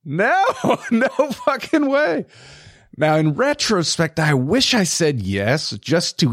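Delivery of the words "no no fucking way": high, slow, laughing no; laughing refusal. Retold or imitated. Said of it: "And I was like".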